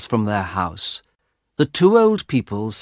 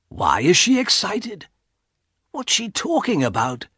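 higher-pitched inward wondering; puzzled thought; 8 semitones higher than usual. A narrator's reading. Husband puzzles over excited wife, inward wondering. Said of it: "he thought".

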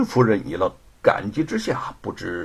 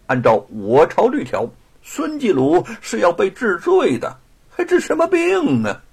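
high, raised, haughty refusal; gloating official bluster; scornful official; 8 semitones higher, 4 dB louder than usual. A narrator's reading. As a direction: pronounce the charge, climbing to a high scoff. Sneering official refuses the plea.

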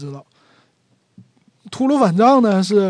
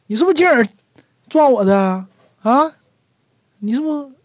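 higher, louder, demanding an answer; accusing confrontation; 7 semitones higher, 4 dB louder, faster than usual. Acted. A man bumped on purpose confronts him, higher and louder accusing. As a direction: confront the guy who bumped you on purpose.

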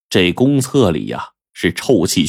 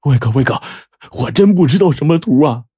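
higher, whining, hurt plea; aggrieved; 7 semitones higher than usual. Acted. Bleeding Zhang Jun pleads his innocence to boss Laowai, whining. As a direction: sound wronged and pitiful. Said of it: "委屈地说".

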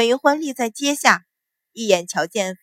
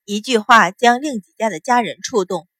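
usual speaking voice

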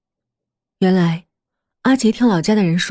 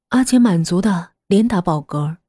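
usual speaking voice

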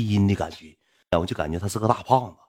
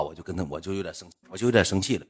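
ordinary talk, nothing marked

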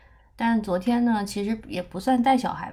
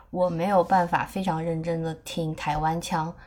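usual speaking voice

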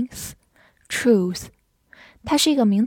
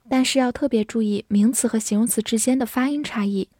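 ordinary delivery

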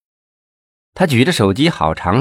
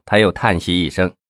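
plain reading voice

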